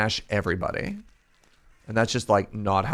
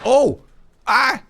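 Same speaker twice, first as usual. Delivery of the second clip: sudden high yelps; startled yelps; 10 dB louder than usual. Real, not acted. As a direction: jump and yelp in surprise. Startled in game fight.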